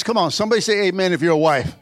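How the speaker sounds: ordinary delivery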